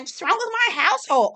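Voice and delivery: very high-pitched imitated indignation; high, mock-defensive question; mock offended. Imitates Kyle taking offence.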